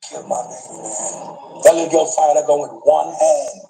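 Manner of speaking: ordinary talk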